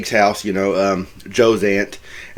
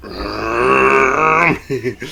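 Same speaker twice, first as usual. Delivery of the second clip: long, droning motor imitation; imitated engine noise. Retelling Bill driving through the rain, imitated engine noise. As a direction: mimic a truck engine with your mouth.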